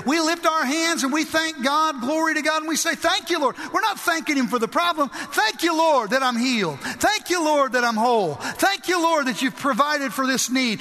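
high-pitched praise, pushing each thank-you; urgent church exhortation; fervent preaching. Real, not acted. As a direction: preach thanksgiving with rising fervor. Preaches thanksgiving at full force.

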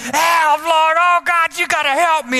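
high, desperate cry for help; panicked plea. Retold or imitated. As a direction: beg God in a panic, voice up high. Panicked prayer in the car.

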